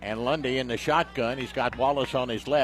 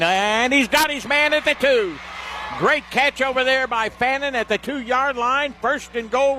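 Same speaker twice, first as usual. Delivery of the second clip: excited call; 9 semitones higher than usual. Real, not acted. Radio announcer calling a big catch, excited. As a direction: lift the voice as the catch is made. Long pass caught at the two.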